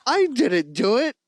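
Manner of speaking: high, drawn-out protest of innocence; mock panicked denial. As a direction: imitate someone caught red-handed, slow and high. Retold panicked denial over body.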